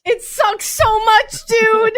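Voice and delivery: slowed, wailing "so much, dude"; real anguished outburst. Real, not acted. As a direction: vent real heartbreak at a fan friend. Grieving a favourite character's death.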